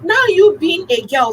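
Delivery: ordinary talk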